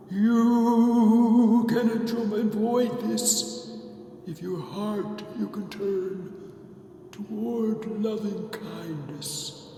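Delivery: wailing, drawn-out spectral voice; haunting spirit with long, swooping vowels; Marley's ghost. Acted. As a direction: moan a slow, eerie warning.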